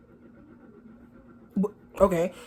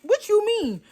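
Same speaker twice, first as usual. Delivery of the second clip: taken aback; 12 semitones higher, slower than usual. Retold or imitated. Taken aback, quoting herself.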